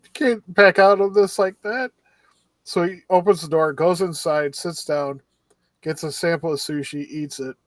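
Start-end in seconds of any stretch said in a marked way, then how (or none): 0.0-1.9 higher, louder pleading push; mock urging. Ishikawa urging his friend into the sushi shop, higher and louder. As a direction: coax him back in.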